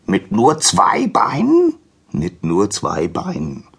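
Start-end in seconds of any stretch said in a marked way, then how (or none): 0.1-1.7 high, drawn-out donkey question; incredulous, high and slow; astonished donkey. Acted. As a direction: stretch it in disbelief, rising at the end. Donkey astonished, echoed by Prometheus.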